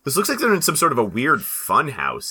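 normal talking voice

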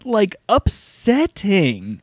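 telling his wife it truly got to him, slowly; haunted, stunned. Retold or imitated. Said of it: "I was like".

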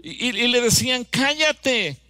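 high, loud scolding shout; disciples shouting. Retold or imitated. Disciples shout down the blind beggar, high scolding yell. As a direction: yell at him to shut up. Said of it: "los discípulos gritaban".

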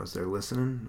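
ordinary delivery

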